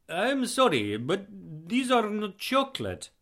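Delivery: high-pitched, earnest Eastern European voice; proud Eastern European guest; heavy Eastern European accent. A narrator's reading. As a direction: present your gift in a thick Eastern European accent. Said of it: "in a heavy Eastern European accent".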